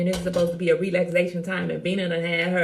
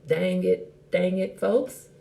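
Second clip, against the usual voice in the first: ordinary delivery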